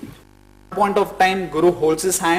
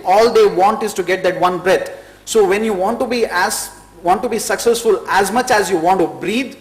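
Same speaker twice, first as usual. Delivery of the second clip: rising, louder motivational push, higher pitch; passionate speech; 4 semitones higher, 3 dB louder than usual. Real, not acted. Speaker urges aspirants to want success like breath, passionately. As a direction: drive the point home with mounting force.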